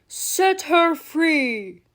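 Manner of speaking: loud, slow pirate captain voice; Captain Hook voice. Acted. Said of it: "In a voice that sounded just like Captain Hook's".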